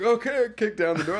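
high, loud retold scramble into action; mock-frantic okay; flustered compliance. Flustered actor obeying cue, retold.

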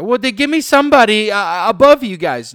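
high, loud entitled demand; insistent Karen. Acted. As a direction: insist on a superior.

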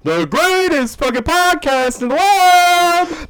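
very high, loud, slow announcer roar; ringmaster. Acted. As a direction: belt it out like a circus ringmaster. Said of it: "Ladies and gentlemen".

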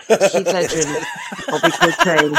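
laughing out the names; giggling through the punchline. Real, not acted. Laughing at dead celebrities joke.

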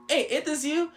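high-pitched retold call to a coworker. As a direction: call over to get someone's attention. Calls out to coworker inside.

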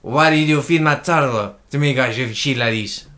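slow Spanish accent; unhurried mock Spanish accent. Shown as a demonstration. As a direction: put on a Spanish speaker's English.

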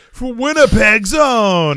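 loud, high, drawn-out hometown introduction; ring announcer. Retold or imitated. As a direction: call it out slowly to the arena. Mimics a ring announcer's call.

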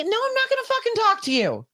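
high, heated rant at pushy strangers; very high-pitched furious outburst; angry rant. Real, not acted. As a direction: tell them off, fed up and furious. Ranting at online strangers.

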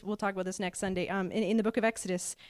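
normal talking voice

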